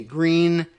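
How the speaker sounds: "Green" said like the color green. Shown as a demonstration. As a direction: pronounce Gruene just as "Green". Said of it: "it's just pronounced Green".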